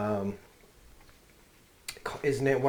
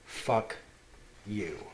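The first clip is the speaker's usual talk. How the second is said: muttered curse; 5 dB quieter than usual. Retold or imitated. Son repeats the curse under breath.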